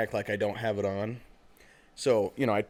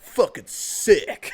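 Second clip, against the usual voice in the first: loud, high, drawn-out hype; mocking enthusiasm; 7 semitones higher, 8 dB louder, much slower than usual. Friend making fun of the cheap tee, mocking. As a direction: stretch it out in sarcastic awe. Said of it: "he's making fun of me".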